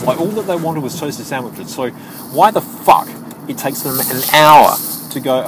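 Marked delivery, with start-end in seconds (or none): 2.3-5.4 loud, high outburst of exasperation; exasperated. Real, not acted. Worker venting about a late order, exasperated. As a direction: vent it, punched hard and high.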